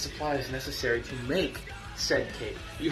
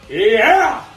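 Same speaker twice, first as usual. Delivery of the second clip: triumphant yell; 7 semitones higher, 17 dB louder than usual. Acted. Heel celebrating his own name.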